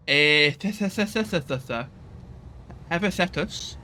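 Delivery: stuttered "th-, th-, th-", then a questioning guess at "Hevacetus?"; stumbling over pronunciation. Real, not acted. Player baffled by an unfamiliar name, stumbling attempt to pronounce it. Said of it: "How the fuck do you pronounce that?".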